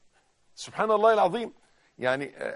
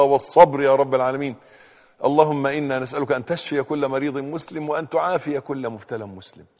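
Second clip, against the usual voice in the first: prayer; 6 dB louder than usual. Acted. Preacher praying for young cancer patients, earnest supplication. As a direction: pray over the sick with steady fervour.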